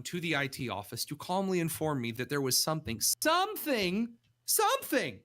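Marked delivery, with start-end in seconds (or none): 3.0-5.2 mock drama. Mocking the calm phone call.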